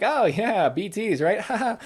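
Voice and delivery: a hint of a smug villain showing off; lightly voiced Higgs. Vlogger imitating the villain bragging about BTs, cocky laughing taunt. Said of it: "the way he's just kinda like".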